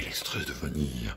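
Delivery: breathy secret whisper of the ingredient. Pretend. Whispers ingredient name theatrically.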